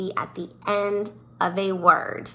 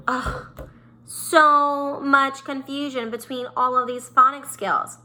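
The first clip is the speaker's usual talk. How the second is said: playful groan, then higher-pitched complaint; mock exasperation; 5 semitones higher than usual. Teacher lamenting tricky phonics rules to pupils, mock exasperated.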